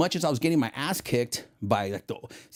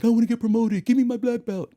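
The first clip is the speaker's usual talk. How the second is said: higher, louder demanding voice; mock the eager American student; mocking whiny student; 12 semitones higher, 6 dB louder than usual. Mocking Americans wanting promotion.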